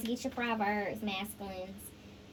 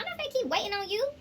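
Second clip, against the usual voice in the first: quoting her in a high, quick, sassy voice; playing the woman who's done waiting, higher and faster; voicing the feminine; 10 semitones higher, faster than usual. To the masculine, mocking the feminine's quick high retort. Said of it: "this feminine said".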